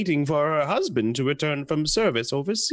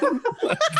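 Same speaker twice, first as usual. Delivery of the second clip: squealing high laugh; real squeal of laughter at the mistake; high burst of laughter. Real, not acted. Breaks up mid-line.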